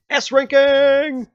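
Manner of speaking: high, loud, drawn-out cheer; triumphant shout. Real, not acted. Player celebrating a top date score, triumphant drawn-out shout. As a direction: crow the winning result, stretched out.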